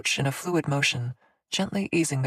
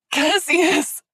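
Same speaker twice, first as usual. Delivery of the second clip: screaming; 7 dB louder than usual. A narrator's reading. Screaming for him.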